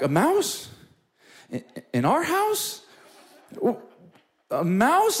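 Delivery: high rising incredulous question; comic incredulity; acted disbelief. Acts his disbelief at wife's news.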